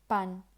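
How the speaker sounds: "pan" with a Spanish p, no puff of air; Spanish unaspirated p. Shown as a demonstration. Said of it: "in Spanish".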